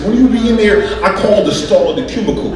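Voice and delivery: ordinary talk, nothing marked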